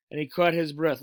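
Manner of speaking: plain reading voice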